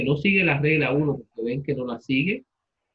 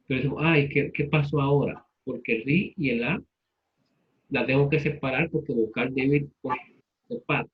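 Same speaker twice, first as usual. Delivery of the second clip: ordinary delivery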